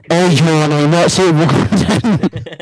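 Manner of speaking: mock warning voice. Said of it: "You know what I could do?".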